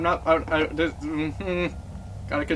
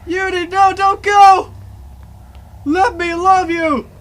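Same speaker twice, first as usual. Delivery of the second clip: loud, high desperate calling; desperate shouting; 12 semitones higher, 10 dB louder, much slower than usual. Real, not acted. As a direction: shout after a friend in panic. Partner disappears, calling after.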